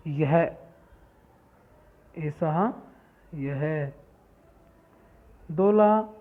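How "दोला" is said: called out. Shown as a demonstration. Teacher announces vocabulary word.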